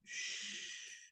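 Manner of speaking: unvoiced hiss of a shush; soft "shh" to silence the mind. Shown as a demonstration. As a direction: shush yourself quietly. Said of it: "Tell yourself".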